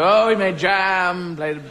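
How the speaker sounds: overacted tearful tribute; mock eulogy. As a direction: drag out the fond memories like a hired mourner. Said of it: "we'll show".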